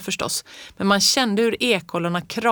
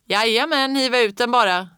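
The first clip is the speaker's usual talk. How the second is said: loud, higher call from outside; calling back; 5 semitones higher, 4 dB louder than usual. A narrator's reading. Fritjof answers Ingeborg from outside: a loud call. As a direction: call back cheerfully from the yard. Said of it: "ropade Fritjof där ute".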